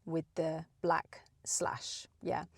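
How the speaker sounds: slow breathy whisper. Shown as a demonstration. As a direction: breathe the words out slowly, unvoiced.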